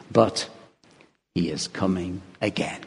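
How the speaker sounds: usual speaking voice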